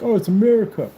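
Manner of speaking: put-on indignant patriot; mocked patriot. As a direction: parrot the objector scornfully. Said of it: "You're like".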